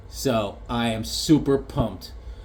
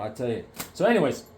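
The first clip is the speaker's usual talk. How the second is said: ordinary delivery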